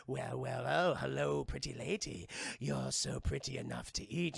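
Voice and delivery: low, soft leering voice; creepy gang leader. Retold or imitated. Gang leader hits on Poison Ivy, low and leering. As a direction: purr it like a sleazy creep. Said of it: "And he's like".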